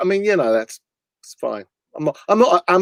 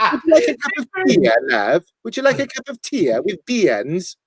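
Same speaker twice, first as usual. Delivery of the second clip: sing-song Welsh cafe lady; mock Welsh accent; 2 semitones higher than usual. Host retelling Welsh breakfast offer in a hammed-up accent. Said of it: "They pronounce every vowel".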